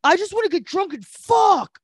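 high-pitched mock excited quote; hammed-up party cry. Imitating the birthday girl's wish, hammed-up and excited. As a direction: whoop it out like a mock party girl.

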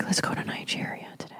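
whispered invitation; hushed whispered suggestion. Retold or imitated. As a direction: lean in and whisper it. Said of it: "he's like".

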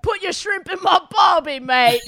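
high, hammed-up Australian shout; mock Aussie accent. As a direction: call it out in broad fake Aussie. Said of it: "That was a different accent".

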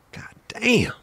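breathless whispered curse; awed whisper. Real, not acted. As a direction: whisper it in disbelief. Awed reaction to skate video.

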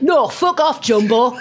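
put-on high, cheeky kid's voice; little child's voice. Child heckling the performer in a high cheeky voice. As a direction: pipe it up like a rude small child. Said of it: "a little voice went".

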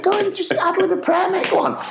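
high, squeezed insistence; urgent coaxing. Retold or imitated. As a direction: push a reluctant mate onstage. Urging reluctant colleague to pray.

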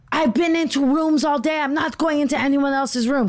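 high-pitched mock exasperation; indignant rant in a high imitating voice; retold indignant complaint. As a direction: imitate her refusing, pitched well up. Retold woman refusing, indignant.

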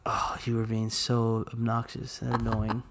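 whispered aside. Real, not acted. Teasing her friend in a whisper. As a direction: breathe it out under the laugh.

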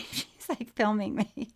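ordinary delivery

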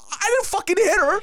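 loud, high mock-panicked denial; hammed-up indignant shriek; freaking out. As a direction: squeal it as if falsely accused. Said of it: "freaking the fuck out".

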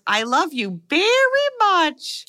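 high, slow toy voice; like a recorded toy bear; teddy bear voice box. Retold or imitated. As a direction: mechanical teddy bear saying I love you. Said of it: "when you squeeze its little paw, it says".

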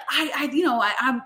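ordinary delivery

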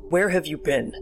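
mildly gruff grandpa; gruff demand. A narrator's reading. Grandpa behind the child at bedtime, mildly gruff demand. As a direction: demand an answer, lightly gruff. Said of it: "a gruff voice demanded from behind me".

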